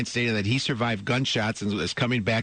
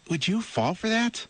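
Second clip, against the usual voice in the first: incredulous; 11 semitones higher than usual. Real, not acted. Host asks listeners.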